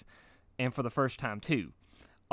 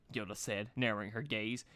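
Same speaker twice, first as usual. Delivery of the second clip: ordinary delivery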